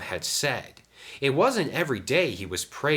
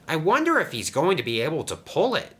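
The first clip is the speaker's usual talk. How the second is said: ordinary delivery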